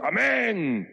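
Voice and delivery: high shouted amen from the inmates; imitating prisoners calling amen; crowd answer. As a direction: answer back like the whole prison. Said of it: "Todos gritan".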